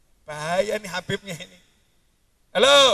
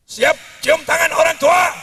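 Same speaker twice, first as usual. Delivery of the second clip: loud, higher rally shout; Habib Rizik shout; 7 semitones higher, 7 dB louder than usual. Retold or imitated. Preacher mimics Habib Rizik rallying the youth, loud shout. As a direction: fire the question at the crowd. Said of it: "Saya tak tiru-tiru Habib Rizik".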